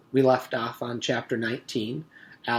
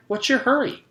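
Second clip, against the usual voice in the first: cheery shrug; 13 semitones higher, 8 dB louder than usual. Acted. Virginia brushing off Allison.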